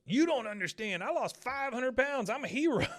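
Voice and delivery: hammed-up outraged boast, laughing at the end; indignant shout. Torch-bearer shouting back at Hank, hammed up. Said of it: "The guy goes".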